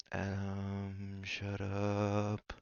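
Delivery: slow, held-back demand for silence; drawn-out warning. Acted. Telling Adam to stop talking, drawn-out warning. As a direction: stretch the name out as a warning.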